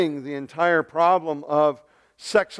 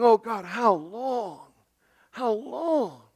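slow, drawn-out higher plea; anguished calling out; 6 semitones higher, much slower than usual. Acted. Habakkuk at prayer calling out to God, anguished. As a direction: stretch "long" into a weary, pleading cry. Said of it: "he's calling out to God".

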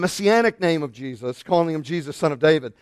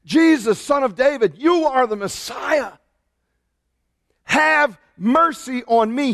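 yelling; 6 semitones higher, slower than usual. Retold or imitated. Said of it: "he yelled even louder".

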